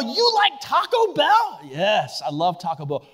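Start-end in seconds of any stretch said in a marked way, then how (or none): 0.0-1.6 put-on high voice. Acted dialogue, high put-on voice.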